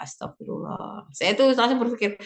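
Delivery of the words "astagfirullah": whispered prayer. Retold or imitated. Mother's worried inner lament.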